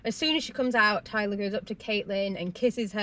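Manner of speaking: normal talking voice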